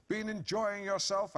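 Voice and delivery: slow, high, heavy innuendo on enjoying; sarcastic drawn-out insinuation; loaded emphasis. Retold or imitated. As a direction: lean hard on enjoying. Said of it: "with loads of emphasis".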